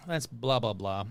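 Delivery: normal talking voice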